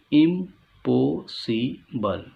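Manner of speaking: इम्पॉसिबल very slow, इम, पॉ, सि, बल with gaps; four-part split. Shown as a demonstration. Teacher counting four parts in इम्पॉसिबल, very slowly split. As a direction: pause after each syllable of इम्पॉसिबल. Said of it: "चार हिस्से हुए".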